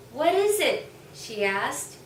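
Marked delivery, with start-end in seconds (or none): 0.1-0.9 higher, louder eager question; curious child. Acted. Bao Bao asking Goonigan about the buzzing. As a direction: ask in eager wonder.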